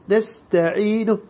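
نستعين ending held for exactly two counts; two-beat ending. Shown as a demonstration. Quran teacher repeating the correct two-count stop for students. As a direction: stretch the end of نستعين only two beats, slowly. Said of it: "حركتين، هكذا".

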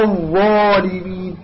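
slow الظالمين with dark rounded ظا; measured tajweed model of الظالمين. Shown as a demonstration. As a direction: stretch ظا full in الظالمين. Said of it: "تستعلي بها".

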